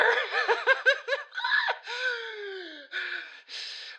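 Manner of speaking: high shouted burst of laughter. Real, not acted. Laughing at his own rant.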